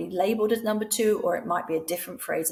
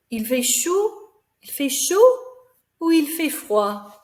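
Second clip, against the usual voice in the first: much higher and louder, Il fait chaud lifting high; animated choice question; 7 semitones higher, 7 dB louder than usual. Shown as a demonstration. Teacher miming weather for the class, high and loud. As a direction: ham it up while miming hot and cold. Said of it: "I've also mimed it".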